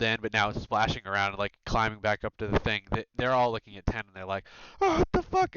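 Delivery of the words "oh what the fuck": very high, loud shocked yell; startled shout. Acted. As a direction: shriek it out in shock. Workers spot creature in water.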